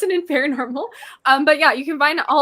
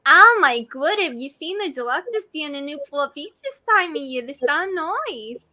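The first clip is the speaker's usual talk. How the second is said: New Jersey accent; 4 semitones higher, 4 dB louder than usual. Shown as a demonstration. Guest shows off her movie-style New Jersey accent. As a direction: stretch the ending in broad New Jersey. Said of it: "really good at a New Jersey accent".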